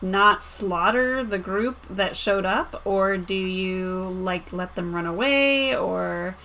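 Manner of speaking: ordinary delivery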